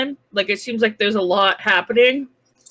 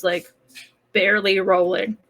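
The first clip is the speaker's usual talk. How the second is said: normal talking voice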